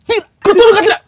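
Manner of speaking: a sharp high scold called from below. Retold or imitated. Mother scolding her daughter from downstairs, sharp and loud. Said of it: "Umi gertak dari bawah".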